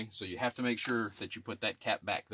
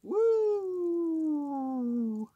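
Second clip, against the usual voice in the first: excited woo; 4 dB louder than usual. Real, not acted. Celebrates drain flowing outside.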